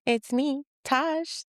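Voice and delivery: ordinary talk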